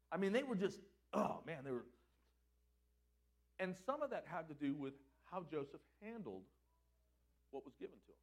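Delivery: usual speaking voice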